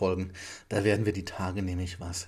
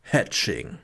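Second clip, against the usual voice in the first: dramatic trailer announcer read; 3 semitones lower than usual. Pretend. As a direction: say the title like a trailer. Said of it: "Muss ja die Trailerstimme machen".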